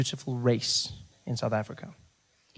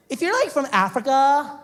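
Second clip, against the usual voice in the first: put-on high valley girl voice, slow on the question; mock the clueless American girl, high and slow; ditzy valley girl; 8 semitones higher, 3 dB louder than usual. Clueless girl asks comedian about Africa, high mocking voice. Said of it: "I said".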